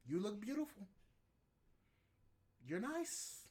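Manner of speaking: like you can't believe the compliment got you cussed out; indignant disbelief. Acted. To a woman who snapped at his compliment, high indignant protest.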